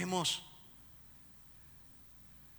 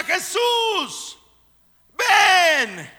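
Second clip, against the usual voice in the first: shouted, much higher cry to Jesus; desperate mother's cry; 11 semitones higher, 20 dB louder, slower than usual. Acted. Mother praying at three in the morning, desperate shout. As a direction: cry out for your son in anguish.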